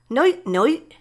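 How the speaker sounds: put-on drunken slurred goodnight. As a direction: slur it like a tipsy dad. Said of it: "he'd say in a slurred voice".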